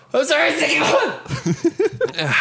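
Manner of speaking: halting, sneeze building; about to sneeze. Real, not acted. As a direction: hold back a coming sneeze. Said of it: "I need to sneeze".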